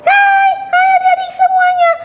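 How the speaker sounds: put-on high, loud squeaky child greeting; puppet girl Mimi. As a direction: burst in as a cheerful little puppet girl. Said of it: "kakak panggil dulu ya".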